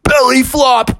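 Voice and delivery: high, loud gleeful cry; belly flop yell. Acted. Bear leaping into mud with a loud, high yell. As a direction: shout it mid-leap.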